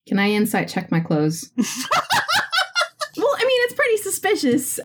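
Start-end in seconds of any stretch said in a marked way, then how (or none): 1.6-3.1 bursting high giggle; high laugh. Real, not acted. Laugh on suspicious clothes. 3.2-4.8 laughing. Real, not acted.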